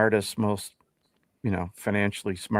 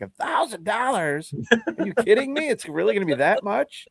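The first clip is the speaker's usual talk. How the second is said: shocked disbelief; 9 semitones higher than usual. Retold or imitated. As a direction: act stunned at the price, pitch way up. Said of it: "I'm like".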